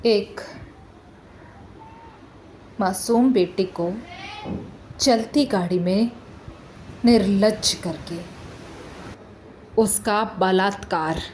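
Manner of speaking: subdued, halting, heavy with pauses; grave recounting. Acted. Lawyer telling court of a girl's assault, grave and halting. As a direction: let each phrase fall quietly and gravely.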